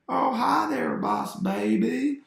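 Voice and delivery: high, sing-out cartoon madman voice; unhinged villain. Villain on videotape greets Boss Baby, unhinged high voice. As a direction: greet in a high, stretched-out crazed voice. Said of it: "talks like that. This guy's really unhinged".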